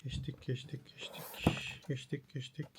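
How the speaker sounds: hushed, whispered muttering; barely voiced, talking to yourself; whispering to self. Real, not acted. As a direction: whisper it absently while skimming pages. Skimming pages, muttering to self.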